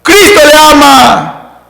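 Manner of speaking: loud, slow, hammering shout; booming slow declaration. Shown as a demonstration. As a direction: blast it like through a megaphone. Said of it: "es diferente que yo le diga".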